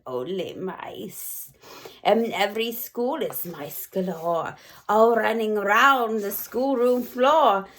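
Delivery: plain reading voice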